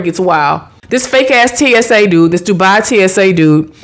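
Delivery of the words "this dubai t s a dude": ordinary delivery, nothing marked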